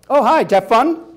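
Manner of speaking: high, loud forced brightness; sting under sweetness; icy fake cheer. Boyfriend greeting Lucy coldly, loud fake cheer.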